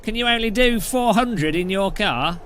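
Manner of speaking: mock a bad Australian caricature; cartoon Australian accent, overdone and cheerful. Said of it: "my Australian accent's not very good".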